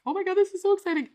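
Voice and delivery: high, fast, gushing squeal of words; imitating yourself bursting with excitement; giddy excitement. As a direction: rush it out in a high, thrilled voice. Quoting own giddy excitement.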